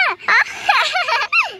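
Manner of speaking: long, high-pitched fit of laughter; uproarious laughter. Acted. Family laughing at the boy's painted face, uproarious laughter. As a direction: laugh your head off. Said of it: "हंस रही हो ऐसे गले फाड़ फाड़ कर".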